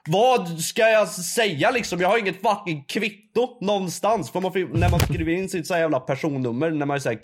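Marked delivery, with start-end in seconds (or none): 0.1-4.3 agitated. Retold or imitated. Heated retelling of the confrontation.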